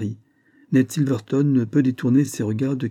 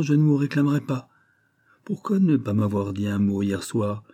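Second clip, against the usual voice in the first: ordinary delivery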